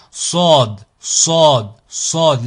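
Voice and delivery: Sod with a heavy, dark emphatic S; emphatic, grave S. Shown as a demonstration. Teacher demonstrating the emphatic letter Sod, heavy and dark. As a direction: hit Sod firmly with a backed, dark sound. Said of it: "on est sur un son emphatique qui est grave".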